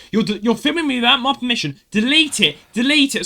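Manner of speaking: imitating an angry woman, high and loud; mock shrieked order, pitched right up; high, insistent, loud complaint; outraged demand. Retold woman demanding deletion.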